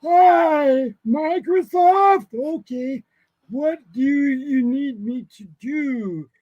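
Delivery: very high, slow, drawn-out silly voice; put-on phone voice. Playing along with the Microsoft scammer, high drawn-out put-on voice. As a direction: stretch it out in an exaggerated falsetto, loud at first.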